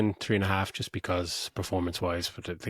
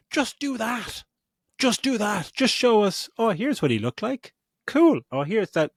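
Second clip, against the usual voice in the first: exasperated suggestion; 10 semitones higher than usual. Retold or imitated. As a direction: insist what they should've done. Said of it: "and just going".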